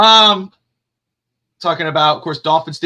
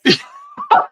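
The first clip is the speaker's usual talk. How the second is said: sudden very high burst of laughter; 4 dB louder than usual. Real, not acted. Host hearing about the [name] tweet, high laughing burst. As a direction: laugh out at the crazy news. Hears surprising news.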